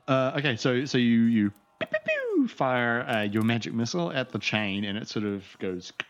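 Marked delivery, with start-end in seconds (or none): 1.2-2.5 pew pew. Acted. GM voices magic missile sounds.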